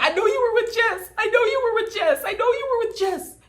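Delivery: high, whiny mock of Chelsea repeating herself. Vlogger mocking Chelsea's nagging, high whiny voice. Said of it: "Chelsea's like".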